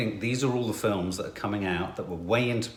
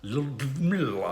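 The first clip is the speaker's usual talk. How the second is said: put-on Russian accent; 4 dB quieter, much slower than usual. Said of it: "My name is Ludmilla".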